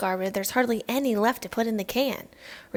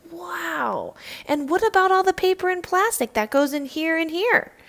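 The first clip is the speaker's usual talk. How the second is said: put-on higher, louder, amazed kid; excited wow; 8 semitones higher, 6 dB louder than usual. Child on a class trip asks the teacher, high and excited. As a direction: burst out with wonder, then rush the question.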